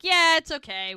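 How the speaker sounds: loud, high yeah; a loud, high, happy yeah; gleeful relief. Retold or imitated. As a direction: burst out with it, delighted. Siblings laughing it off.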